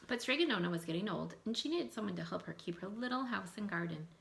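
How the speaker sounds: put-on reading a folktale aloud to children, lively; story narration. A narrator's reading. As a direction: tell it like a storybook to a class. Teacher reads folktale aloud.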